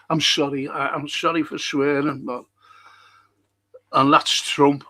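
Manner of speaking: usual speaking voice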